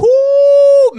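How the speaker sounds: a sudden high, loud whoop; excited whoop. Real, not acted. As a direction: let out a big high woo. Reacting to being made the villain.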